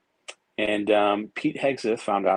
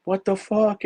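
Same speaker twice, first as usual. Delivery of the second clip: much higher, startled retold exclamation; stunned disbelief; 11 semitones higher, 3 dB louder, slower than usual. Hearing the president on the line, a startled higher blurt. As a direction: blurt it in shock at who's calling. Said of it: "And I'm like".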